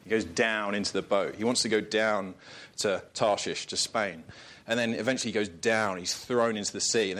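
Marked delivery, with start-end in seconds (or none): none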